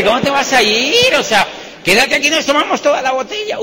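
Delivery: acted-out incredulous coaxing; insistent coaxing. Acting out urging guest to stay.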